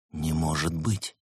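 soft, slow, hushed murmur; whispered disbelief. A narrator's reading. Лаэрт staring at the stranger's face, hushed disbelief. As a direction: drop to a slow, hushed undertone. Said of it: "прошептал он".

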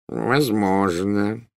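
very slow, drawn-out reluctance; noncommittal. A narrator's reading. Shopkeeper avoids promising Malfoy a repair, noncommittal. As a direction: stretch the word out, unwilling to commit. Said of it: "По его голосу ясно чувствовалось, что он не хочет брать на себя".

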